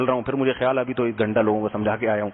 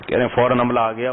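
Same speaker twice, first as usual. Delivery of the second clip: ordinary talk, nothing marked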